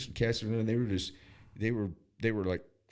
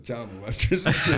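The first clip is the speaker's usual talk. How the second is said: normal talking voice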